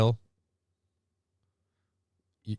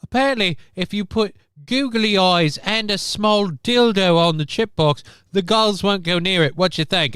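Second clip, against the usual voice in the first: higher, lightly hammed reporter voice; mock news reporter; 7 semitones higher, 3 dB louder than usual. Mocking the reporter's googly eye segment, higher hammed-up tone. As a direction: spoof a TV reporter asking a question.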